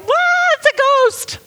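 mock-terrified high shriek; freaked out. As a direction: squeal it out like you've seen a ghost. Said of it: "they freaked out again 'cause they're like".

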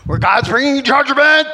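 loud, high mimicked proclamation; mock doom preacher. Preacher mimicking those who incite people with judgment, mock thundering.

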